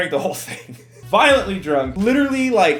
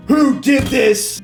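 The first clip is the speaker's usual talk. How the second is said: loud, higher mock-furious yell, slowed down; incredibly angry; 8 semitones higher, 7 dB louder, much slower than usual. Storyteller imitating the RA's email, incredibly angry. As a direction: yell it out word by word, imitating outrage. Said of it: "an incredibly angry email".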